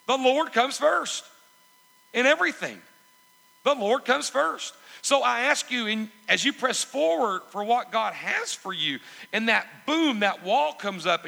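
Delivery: loud, high, driving sermon delivery; impassioned preaching. Real, not acted. Preacher urging the congregation to put the Lord first, impassioned preaching. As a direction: preach it out at full force.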